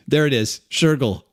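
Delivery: normal talking voice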